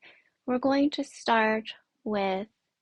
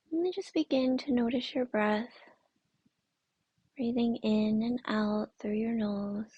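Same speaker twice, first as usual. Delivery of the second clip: guided meditation. Acted. Yoga teacher centering the class on their breath, guided meditation. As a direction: guide them gently inward, unhurried and soothing.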